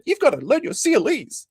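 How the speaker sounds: put-on high, squeaky childish whine; mock tantrum. Host imitates Nick stamping his feet, high childish whine. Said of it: "stamped on the ground, and said".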